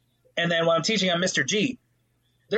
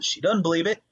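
usual speaking voice

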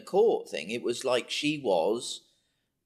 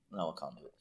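ordinary delivery

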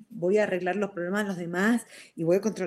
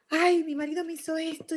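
higher, whining voice, playfully imitating herself; mock whiny complaint; 9 semitones higher than usual. Wife mocking her own complaint about the husband, higher and whiny. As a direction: whine it like a put-upon wife.

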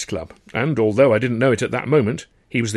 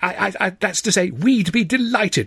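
stammering delight; 10 semitones higher than usual. Acted.

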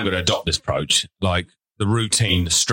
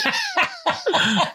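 shrill laughing cry; high shrieking laugh. Real, not acted. Reacting to son's remark.

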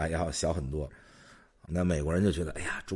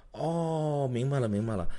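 sudden realization; 8 semitones higher than usual. Retold or imitated. Shop clerk suddenly understanding.

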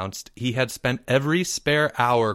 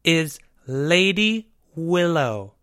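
slow, higher grand title; confident announcement; 5 semitones higher, slower than usual. A narrator's reading. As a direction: present the title with pride. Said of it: "he announced confidently".

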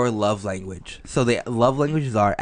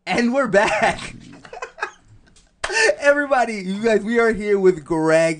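excited host; 10 semitones higher than usual. Real, not acted. Host returning from break.

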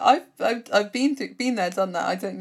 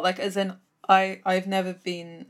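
normal talking voice

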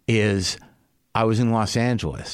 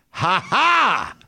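gloating laugh; 8 semitones higher, 3 dB louder than usual. Retold or imitated. Gloating at ex-wife's reaction.

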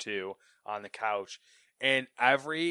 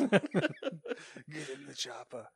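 action hero voice; 4 semitones higher, 3 dB quieter, much slower than usual. Pretend.

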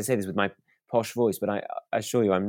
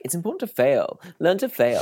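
mocking quote; 8 semitones higher, 4 dB louder than usual. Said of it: "when people say, like".

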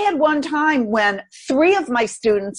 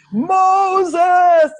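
loud, high, booming call of "Moses!"; booming God voice; 10 semitones higher, 7 dB louder than usual. Retold or imitated. Mocking the Hollywood God calling out to Moses, booming. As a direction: boom it like a movie God. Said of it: "Charlton Heston and the Ten Commandments".

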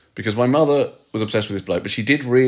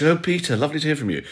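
high, plummy telephone manners; posh voice; 6 semitones higher than usual. Retold or imitated. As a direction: put on your best posh phone voice. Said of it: "puts on a kind of posh voice".

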